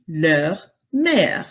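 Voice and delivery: "leur mère" in French, rounded eu; French pronunciation. Shown as a demonstration. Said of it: "Their mother would be".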